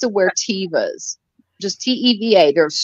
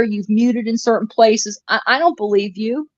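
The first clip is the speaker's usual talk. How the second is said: ordinary talk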